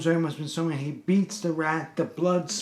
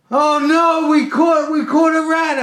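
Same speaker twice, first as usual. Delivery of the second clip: high, loud mock-hysterical wail; retold hysterical screaming; screaming, crying customer; 12 semitones higher, 8 dB louder, slower than usual. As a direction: wail it in panic, imitating a frantic client. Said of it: "He's screaming, he's crying".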